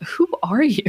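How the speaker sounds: offended. Retold or imitated. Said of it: "I was like".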